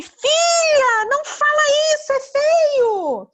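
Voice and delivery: very high, loud mock-horrified mum; scolding mother. Mother scolds her daughter for rudeness, high loud reproach. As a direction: cry out as an appalled mother. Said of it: "Aí a mãe".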